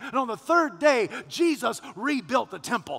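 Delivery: loud, rising gospel preaching; full-force preaching. Acted. Pastor preaching the resurrection to his congregation, loud and building. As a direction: preach it out to the whole church.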